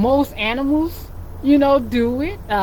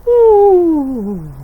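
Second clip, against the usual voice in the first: loud drawn-out bear snore; a big, open snore to make the kids laugh; 6 semitones higher, 8 dB louder than usual. Shown as a demonstration. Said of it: "Can you snore like a bear?".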